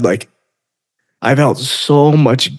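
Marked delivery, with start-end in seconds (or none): 1.2-2.6 emotional. Real, not acted. Recalling guilt over daughter.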